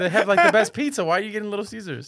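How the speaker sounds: amazed mock outrage; incredulous laugh. Real, not acted. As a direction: gasp at the choice laughing.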